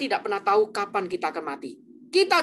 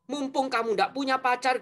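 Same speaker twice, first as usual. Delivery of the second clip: ordinary talk, nothing marked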